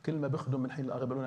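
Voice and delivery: ordinary delivery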